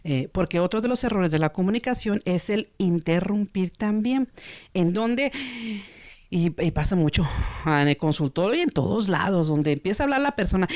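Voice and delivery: usual speaking voice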